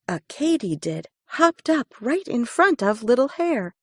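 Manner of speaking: usual speaking voice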